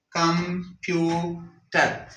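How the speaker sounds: "कंप्यूटर" slow, in three separate beats; broken into syllables. Shown as a demonstration. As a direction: pause between com, pu and ter in "कंप्यूटर". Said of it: "syllables को अलहदा करने के लिए". ("कंप्यूटर" हर सिलेबल अलग करके)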